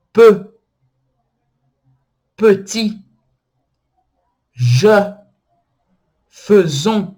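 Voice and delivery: "P, petit, je, faisons" loud, with rounded euh; French schwa examples. Shown as a demonstration. Teacher giving examples of the French E, emphatic pronunciation demo. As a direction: model each word separately and firmly: petit, je, faisons. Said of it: "exemplos de sons que utilizam A".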